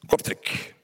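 whispering the name of the town; whispered. Acted. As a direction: breathe the word Kortrijk softly. Answering his own question. (fluisterend, zacht uitgesproken Kortrijk)

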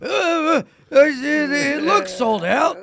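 high, loud groaning dreamer; mock sleep talk. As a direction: groan and stammer as a troubled sleeper. Joking as the sleep-talker dreaming.

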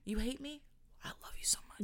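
whispered sickly-sweet love; mock sweet whisper. Podcaster answers her haters with a sweet whisper. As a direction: smother the hater with a soft whisper.

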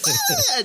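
put-on high, loud gleeful baby shriek; baby's happy squeal. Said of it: "Baby's just like".

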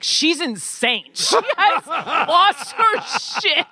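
slow, high, laughing through it; half-laughing mock outrage at the crazy prop; amused disbelief. Actress recalling the blank puzzle, slow amused disbelief. Retelling her reaction to a prop.

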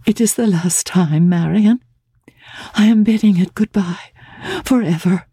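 saying goodbye to a lost love; Laura's farewell. Acted.

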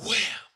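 sudden loud, high imitated bang; slamming door imitation. Storyteller imitates a door slamming during a meeting, loud and sudden. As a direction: burst out with the slam. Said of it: "they all hear".

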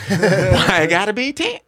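mocking wives who argue, laughing and slow; laughing mock whine. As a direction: ham up an arguing woman through laughter. Mimicking women arguing.

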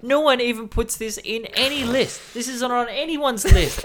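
high-pitched imitation of a complaining friend; indignant mock protest. Friend objects that nobody lists this album, mock indignation. Retelling Charlie's protest about the list.

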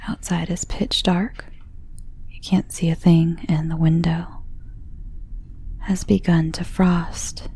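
ordinary delivery, nothing marked

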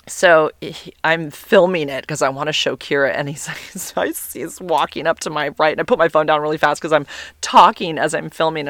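ordinary talk

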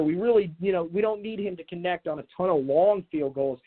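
ordinary talk, nothing marked

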